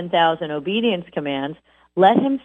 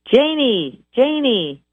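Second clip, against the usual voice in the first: high, repeated called-out name; parent calling a child; 7 semitones higher, slower than usual. Retold or imitated. Parent calling their child over and over, high and called out. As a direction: call the kid home again and again. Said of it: "If your parents called you".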